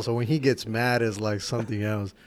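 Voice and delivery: ordinary talk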